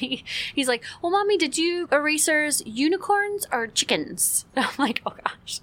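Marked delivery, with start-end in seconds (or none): 1.0-4.5 put-on high little-kid voice asking; a mother mimicking her son's question; curious child voice. 5.1-5.6 soft whispered aside; whispered gosh. Retold or imitated. Mom's reply, laughing aside.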